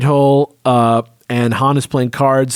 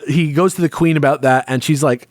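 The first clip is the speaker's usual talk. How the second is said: ordinary delivery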